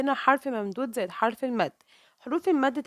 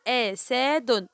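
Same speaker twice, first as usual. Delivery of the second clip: "أسد" drawn out, each letter sounded apart; teacher segmenting "أسد"; syllable by syllable; much slower than usual. Shown as a demonstration. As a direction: say "أسد" very slowly in separate pieces. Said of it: "نطقت كل حرف لوحده".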